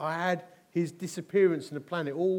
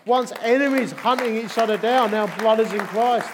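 rising preaching fervour; 5 semitones higher, 9 dB louder than usual. Real, not acted. Climax of testimony, preaching loud.

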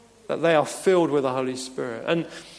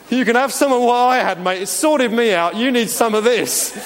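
higher, louder matey offer; hammed-up Paul; 6 semitones higher, 5 dB louder than usual. Pastor acting Paul offering his healing, higher and louder. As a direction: sell it like a bloke sharing a cure. Paul's offer voiced again, bigger.

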